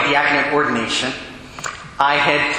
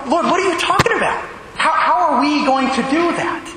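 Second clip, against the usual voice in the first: baffled apostles; 10 semitones higher than usual. Retold or imitated. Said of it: "They're probably going".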